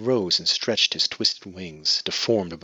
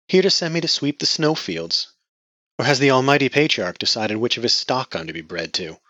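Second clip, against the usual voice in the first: bitter sarcasm; 6 semitones higher, 7 dB louder than usual. Acted. Bitter woman mocks.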